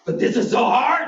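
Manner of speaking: high-pitched complaining imitation; mock freaking out. Brother-in-law struggling to pay for groceries, mock freaking out. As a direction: wail it like someone overwhelmed. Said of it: "when you're freaking out".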